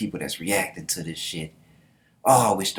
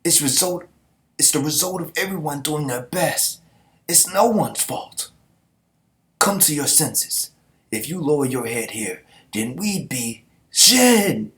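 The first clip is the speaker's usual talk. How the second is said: mock heroic pep talk, pitched high; firm rebuke; 7 semitones higher, 5 dB louder than usual. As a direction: snap him out of it, forceful. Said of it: "Shooter's like".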